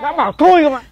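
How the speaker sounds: exasperated scolding. Acted. Husband orders wailing wife to stop, exasperated scolding. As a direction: cut across the crying, fed up.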